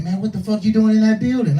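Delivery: high, incredulous driver; astonished. Retold or imitated. Driver finds rider at the strange building, astonished. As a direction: demand an explanation in high-pitched disbelief.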